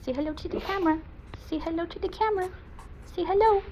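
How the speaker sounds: very high, singsong dog-talk; pet-talk coaxing. Real, not acted. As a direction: coax the dog in a tiny high voice.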